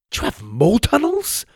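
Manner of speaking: high, bright-eyed question; eager and delighted; beaming. A narrator's reading. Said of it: "I beamed".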